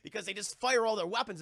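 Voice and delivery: normal talking voice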